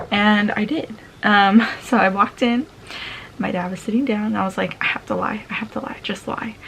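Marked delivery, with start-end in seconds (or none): 4.8-6.6 softer, whispered inner voice; telling herself to lie as she walks up to dad, whispered. Retold or imitated. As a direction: whisper it like a secret plan. Inner thought before facing dad.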